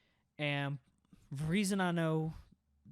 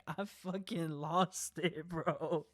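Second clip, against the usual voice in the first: usual speaking voice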